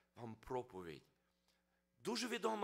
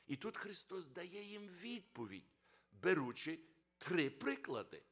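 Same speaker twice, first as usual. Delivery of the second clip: usual speaking voice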